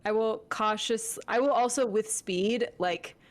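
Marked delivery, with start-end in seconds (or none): none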